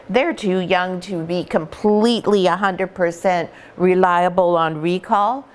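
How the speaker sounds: usual speaking voice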